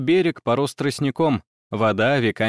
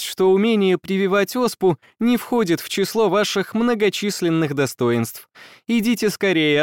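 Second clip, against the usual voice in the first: raised, lightly amused lady's voice; young woman voice; 8 semitones higher than usual. A narrator's reading. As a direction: tease him kindly, a bit higher. Emily laughing, teasing.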